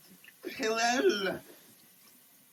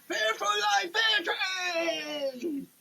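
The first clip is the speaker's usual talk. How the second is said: excited shout; 6 semitones higher than usual. Pretend. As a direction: blurt it out triumphantly. Triumphant label reading.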